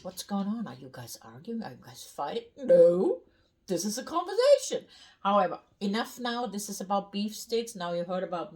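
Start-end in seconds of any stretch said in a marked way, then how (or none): 0.0-2.5 concerned onlookers. Retold or imitated. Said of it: "people did ask us". 2.6-4.9 high, loud, rushed retort; loud protest. Acted. German answering onlookers who suspect a fight, loud protest.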